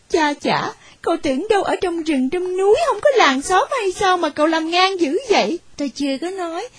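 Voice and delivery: ordinary delivery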